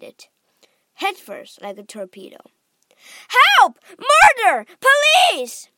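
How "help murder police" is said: high, loud panicked shrieks; screaming. A narrator's reading. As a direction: hysterical mother crying for help. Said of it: "screamed Missus Gloop".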